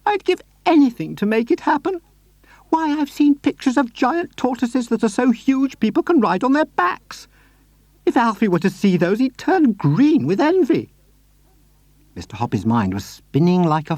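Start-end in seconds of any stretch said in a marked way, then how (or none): 0.0-10.9 put-on high, sweet old lady voice; Mrs Silver voice. A narrator's reading. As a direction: lift into a gentle, doting lady.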